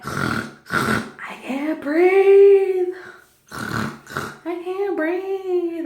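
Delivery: pig-like snorts, then high drawn-out wail; snorting panic. Retold or imitated. Mother in labor under oxygen mask, snorting and wailing. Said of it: "snorting like a fat pig in heat".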